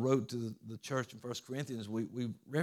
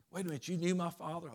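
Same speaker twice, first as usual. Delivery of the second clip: quick, higher-pitched mock astonishment; retold surprise; 6 semitones higher, faster than usual. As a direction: blurt it as a startled question, imitating Simba. Simba suddenly learns monkey knew father.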